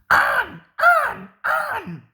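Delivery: high loud trucks' yell. A narrator's reading. Trucks yelling as they push Mavis downhill. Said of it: "they yelled".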